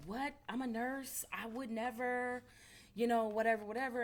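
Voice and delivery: high, pleading "what did I do"; panicked nurse. Retold or imitated. Nurse confronted by Target staff, high and pleading. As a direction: play the confused nurse denying it. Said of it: "she was like".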